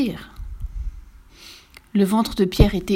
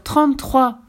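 louder, higher, firm model phrase; insistent doctor, voice raised; 5 semitones higher, 5 dB louder, slower than usual. Acted. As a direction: spell it out insistently for a patient. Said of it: "insista le docteur".

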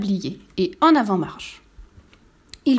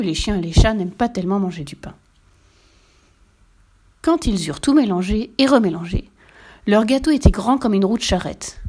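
ordinary delivery

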